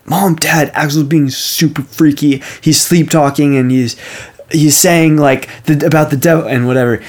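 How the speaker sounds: mock-panicked kid reporting to Mom and Dad; freaked-out Avery. Avery running to tell the parents, mildly freaked out. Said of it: "Avery just gets freaked out".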